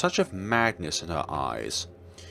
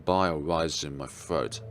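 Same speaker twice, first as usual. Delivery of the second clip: usual speaking voice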